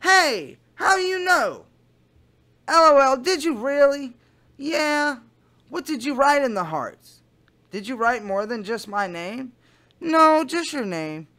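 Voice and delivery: lightly voiced excited girl; Kayla voice. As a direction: play the giddy teen girl Kayla. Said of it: "my Kayla voice".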